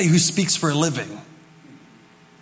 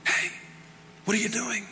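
soft, higher gentle call; 7 semitones higher, 8 dB quieter than usual. Retold or imitated. God calling the pastor, soft and gentle. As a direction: coax him quietly. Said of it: "he's going".

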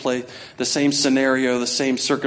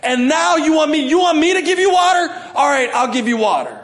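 much higher, louder enraged yell; angry shout; 13 semitones higher, 5 dB louder than usual. Retold or imitated. Moses yelling at the rebels before striking, furious. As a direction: blast it at them, fed up. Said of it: "in his anger".

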